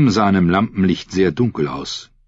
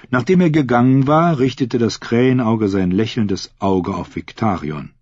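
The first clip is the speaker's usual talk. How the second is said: plain reading voice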